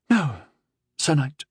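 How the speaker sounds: put-on mildly reluctant girlish reply. A narrator's reading. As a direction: admit it reluctantly, pausing midway.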